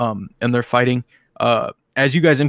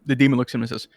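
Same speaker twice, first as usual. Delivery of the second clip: ordinary delivery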